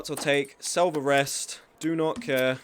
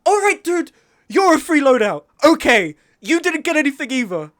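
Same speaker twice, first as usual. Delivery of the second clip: much higher, loud exasperated yelling; angry outburst; 10 semitones higher, 8 dB louder than usual. Real, not acted. Streamer yelling at an enemy player, high and loud. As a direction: shout back in disbelief.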